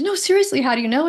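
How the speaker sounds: insistent amazement. Retold or imitated. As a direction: demand to know, half amazed. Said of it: "She goes".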